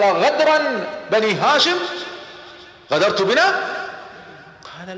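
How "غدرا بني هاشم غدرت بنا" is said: startled accusation. Acted. Abu Sufyan startled, fears betrayal.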